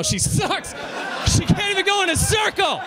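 comic yelling a joke about a NASCAR driver; laughing shout. Acted. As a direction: yell the punchline through a laugh. Punchline yelled over laughter.

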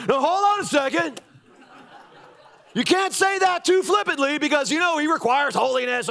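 a preachy objector, lightly voiced; imitating a pious critic. Churchgoer from back row lecturing pastor, mock-prim. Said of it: "some bloke in the back row that's like".

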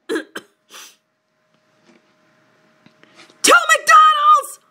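high, loud rallying shout; big mock-heroic war cry; battle cry. A narrator's reading. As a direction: bellow it like a charge into battle. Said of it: "was our battle cry".

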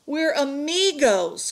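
high, loud, drawn-out protest; crying out. A narrator's reading. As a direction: cry it out slowly in protest. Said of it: "cried Miguel".

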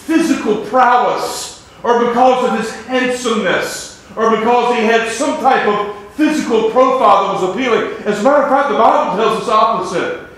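loud, high preacher's cry; a revival preacher at full force; full-force preaching. Acted. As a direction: preach it out to the whole church. Preaching on Jesus' appearance.

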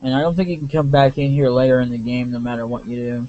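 ordinary talk, nothing marked

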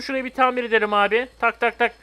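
normal talking voice

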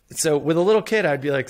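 ordinary talk, nothing marked

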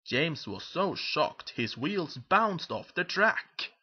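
ordinary delivery, nothing marked

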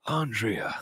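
hushed inner-voice impression; Kevin Conroy. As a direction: say it softly, as a brooding thought. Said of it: "in his own head of Kevin Conroy".

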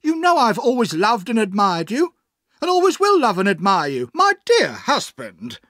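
high, loud impassioned protest; hammed-up adoring wife, pitched high; crying out as wife. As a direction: declare undying devotion, melodramatically. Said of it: "cried his wife".

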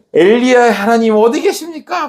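high, exasperated shout to heaven; acted outburst of despair and annoyance; despairing temper. Elisha with Elijah's cloak at the water, acted despairing temper. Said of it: "승질 부리면서".

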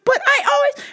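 very high gasping oh; high startled lady. Retold or imitated.

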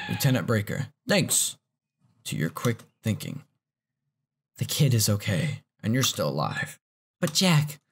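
low, steady voiced-over dialogue read; reading game lines. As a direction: read the subtitles in character.

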